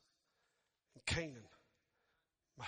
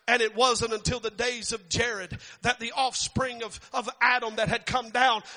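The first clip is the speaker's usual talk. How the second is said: higher and much louder, building to a shout; rising sermon fervor ending in a shout; preaching at full force; 7 semitones higher, 16 dB louder than usual. Real, not acted. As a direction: preach it, climbing to the rafters.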